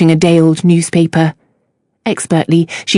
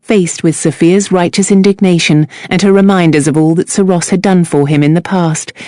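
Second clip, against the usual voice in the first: ordinary delivery, nothing marked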